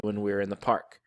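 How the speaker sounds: ordinary delivery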